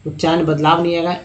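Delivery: usual speaking voice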